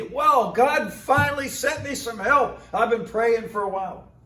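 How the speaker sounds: thanking God out loud for help; overjoyed pastor. Retold or imitated. Pastor voiced, relieved and excited.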